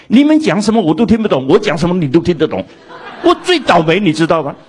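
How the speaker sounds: 音调高、声音大的诉苦; 扮演委屈的英国人，高声发牢骚; 模仿大声抱怨. Retold or imitated. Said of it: "英国人有一次跟我抱怨". (高声诉苦，半开玩笑)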